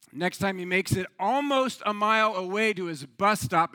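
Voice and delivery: usual speaking voice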